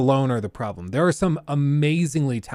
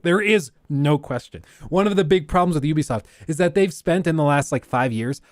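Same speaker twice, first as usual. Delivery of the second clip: ordinary talk, nothing marked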